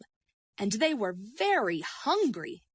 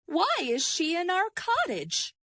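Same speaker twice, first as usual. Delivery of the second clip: asking Mother Bear; 9 semitones higher than usual. A narrator's reading. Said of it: "asked Mother Bear".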